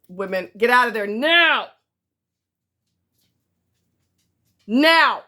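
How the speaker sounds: loud sharp order, rising to a yell; calling out sharply. Real, not acted. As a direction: bark it across the room to get them out. Shouting at someone off camera.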